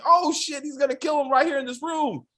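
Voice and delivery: high, worked-up retold alarm. Viewer fearing detective will kill suspect, excitedly alarmed. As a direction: relive the shock in a high voice.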